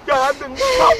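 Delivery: high, weepy plea; tearful pleading. Acted. Lover begging her not to cry, high tearful plea.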